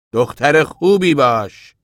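loud, high, drawn-out warning; sharp, angry. A narrator's reading. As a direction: order her slowly and forcefully.

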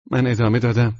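usual speaking voice

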